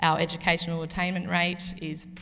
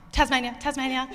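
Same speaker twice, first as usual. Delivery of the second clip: higher, insistent double call of Tasmania; eager insistence; 7 semitones higher, 4 dB louder, faster than usual. Retold or imitated. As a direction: push in proudly before anyone else answers. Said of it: "and I'm like".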